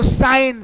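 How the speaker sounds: "साइन" with eye vowel and silent g; correct pronunciation. Shown as a demonstration. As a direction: stretch out "साइन" as sain, no g. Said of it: "सिग्न नहीं".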